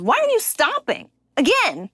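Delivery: lightly voiced scolding little girl; child asking. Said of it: "she goes".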